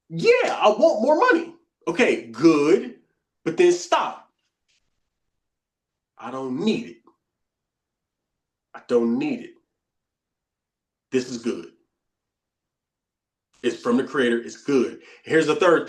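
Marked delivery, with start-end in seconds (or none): 0.1-1.5 mock desperate craving. Acting out craving for money. 6.2-15.0 calm self-talk. Acted.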